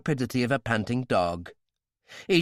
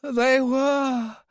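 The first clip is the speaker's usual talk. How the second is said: high, loud panicked telling; desperate pleading; 14 semitones higher, 5 dB louder than usual. Acted. Royale convincing Talbot, high and loud in desperation. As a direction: pour it out to save his life.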